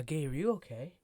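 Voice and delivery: concerned teacher. Retold or imitated. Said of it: "she's like".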